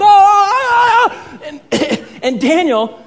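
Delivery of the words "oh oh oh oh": high, loud wailing battle cry; karate attack yell. Retold or imitated. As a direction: imitate a martial artist's flurry of cries. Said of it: "He's like".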